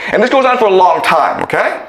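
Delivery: ordinary delivery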